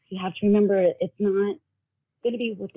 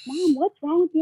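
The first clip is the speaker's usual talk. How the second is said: higher, louder imitation of a worried child; 8 semitones higher, 4 dB louder than usual. Son to his bawling mom, worried. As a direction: imitate a child asking with mild alarm.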